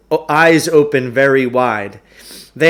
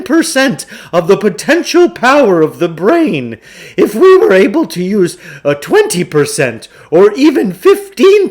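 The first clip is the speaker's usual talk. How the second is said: much higher and louder, booming showman's pitch; grandiose huckster; 6 semitones higher, 5 dB louder than usual. Acted. Huckster opening his lecture to two boys, grandly booming. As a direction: oversell every word like a snake-oil salesman.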